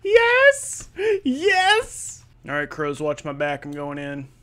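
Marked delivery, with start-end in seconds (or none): 0.0-2.2 hammed-up high squealing excitement; wildly excited. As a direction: squeal with glee, far above your usual pitch. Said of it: "running like he's so excited".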